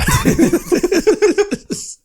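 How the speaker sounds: a big, high cackle; high cackling laugh. Real, not acted. Laughing at joke.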